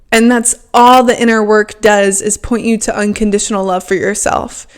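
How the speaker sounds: ordinary delivery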